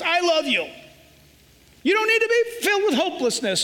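voicing Jesus, firm and loving. Jesus correcting a scared believer, firm and loving. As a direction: scold like a caring father. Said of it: "he says".

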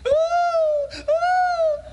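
high falsetto wailing ooh; hammed-up paid mourner's cry; mock mourner wail. Preacher mimicking mourners at the child's house, mock high wail. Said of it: "They probably thought".

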